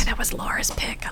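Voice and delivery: mock-reverent whisper; soft, voiceless aside; hushed whisper. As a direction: whisper it as if not to disturb a great song. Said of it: "Why are we whispering".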